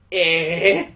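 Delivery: loud startled yelp. Acted. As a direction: jump at a sudden voice by your ear. Startled by voice at her ear.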